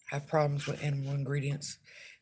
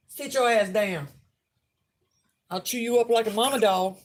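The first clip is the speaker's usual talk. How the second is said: scolding the dogs; 5 semitones higher, 4 dB louder than usual. Real, not acted. Scolding her dogs off puppy.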